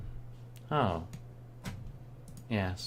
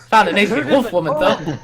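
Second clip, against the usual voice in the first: higher, louder through laughter; laughing; 10 semitones higher, 9 dB louder than usual. Real, not acted. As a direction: giggle through the words. Laughing while saying it.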